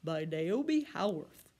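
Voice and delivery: usual speaking voice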